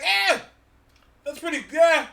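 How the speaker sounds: high, drawn "eh", then hedging; acted noncommittal shrug. Host replaying his reaction to the trailer, noncommittal shrug.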